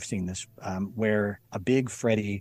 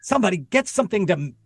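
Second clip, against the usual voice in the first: raised-pitch exasperated mock yell; agitated, higher and a touch louder, acted out; retold screamed order; 5 semitones higher, 4 dB louder than usual. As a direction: imitate a fed-up woman ordering the crew. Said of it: "screaming, and she said".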